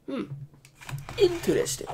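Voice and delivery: strained, losing voice; hoarse voice. Real, not acted. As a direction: voice cracking up high. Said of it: "I'm losing my voice now".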